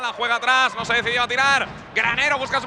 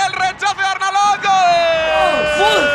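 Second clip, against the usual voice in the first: goal commentary; 9 semitones higher, 6 dB louder, much slower than usual. Acted. Live goal call.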